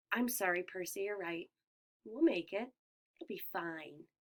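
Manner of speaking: soft, comforting apology; reassuring. A narrator's reading. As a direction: gently reassure a friend. Annabeth comforting Percy softly.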